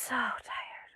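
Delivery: slow breathy whisper of tiredness; sleepy hushed whisper. Acted. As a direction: whisper it like he's falling asleep. Storyteller whispers Samson's fatigue.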